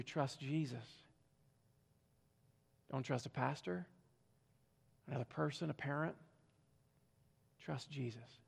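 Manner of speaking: soft, low, earnest plea; tender appeal. Acted. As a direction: urge them gently.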